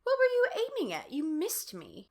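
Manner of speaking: put-on high, loud girl's voice, indignant; Sherry complaining about the photo; girly accusing voice. A narrator's reading. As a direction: play a bratty twelve-year-old girl.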